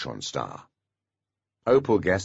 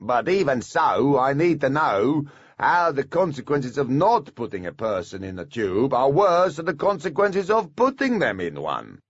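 dwarf voice; 7 semitones higher, 3 dB louder than usual. A narrator's reading. Dwarf argues with pixies.

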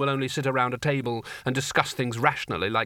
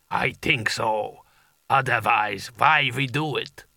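giant alien booming voice; 2 semitones lower, slower than usual. A narrator's reading. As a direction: rumble it out like an ice giant. Giant figure in story.

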